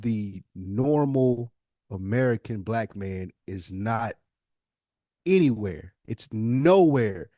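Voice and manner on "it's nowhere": ordinary talk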